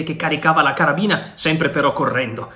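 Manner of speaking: ordinary delivery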